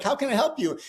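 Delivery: lightly voiced young stewardess, pitched high; hint at a girlish, high service voice; young flight attendant. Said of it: "this young girl".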